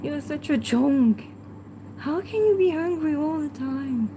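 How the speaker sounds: normal talking voice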